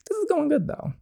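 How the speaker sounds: squeaky high voice. Real, not acted. Gamer commenting on the scene, squeaky high voice. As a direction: pop the comment up into a tiny squeak.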